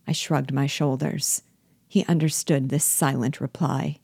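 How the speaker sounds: plain reading voice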